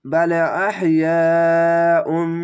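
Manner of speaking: বাল আহিয়াউন chanted slowly, long vowel held; Quranic recitation. Shown as a demonstration. Teacher recites the phrase as a model. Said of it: "এখানে উন বলে এখানে ইং বলতে হবে".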